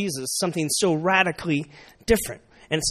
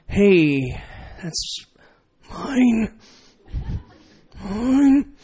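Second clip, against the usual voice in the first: mock possessive yell; 4 semitones higher, much slower than usual. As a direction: imitate an inner toddler's yell in a raised pitch. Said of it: "we really are kinda yelling".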